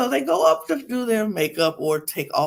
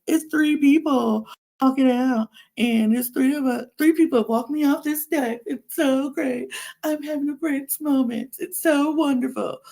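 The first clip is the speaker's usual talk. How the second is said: hammed-up dreamy boasting, high-pitched; mock gushing; 7 semitones higher than usual. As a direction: imitate her showing off how wonderful it is. Mocks her performative gushing.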